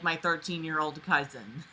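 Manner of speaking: usual speaking voice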